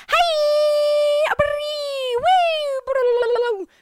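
high, squealing cry of welcome; shrill fake-delighted shop clerk gushing; overeager shop greeting. Store clerk squealing hello at a customer. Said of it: "they're not like".

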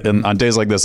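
ordinary talk, nothing marked

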